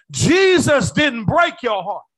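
loud and slow, Jesus didn't break your heart hammered home; preached shout. Acted. Preacher shouting to a hurting congregation, loud and slow. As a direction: cry it out across the church, slowly. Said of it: "That's why I'm emphasizing this".

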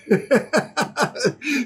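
high-pitched rippling chuckle; laugh. Real, not acted. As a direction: laugh in quick high bursts. Laughs at his thumbnail reasoning.

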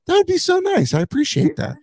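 high-pitched, overly nice thanks; mock-sweet gratitude. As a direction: thank him in a put-on high, polite voice. Acts grateful reply to annoyed golfer.

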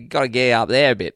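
broad Aussie from the back of the throat; throaty Aussie accent. Shown as a demonstration. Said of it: "come from the back of your throat".

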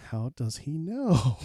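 drawn-out, incredulous question; mock disbelief. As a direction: stretch it out in comic amazement. Retold astonishment at the pastor's words.

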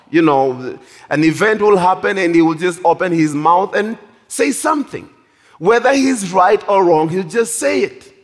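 normal talking voice

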